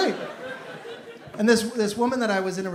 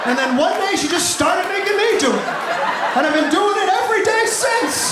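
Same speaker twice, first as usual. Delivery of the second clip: higher, louder, building to a yell; building shout; 9 semitones higher, 4 dB louder than usual. Real, not acted. Comic shouts his closing punchline to the crowd. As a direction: ride the laugh up to the finish. Punchline climax over laughter.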